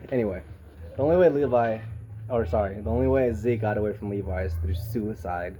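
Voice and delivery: ordinary talk, nothing marked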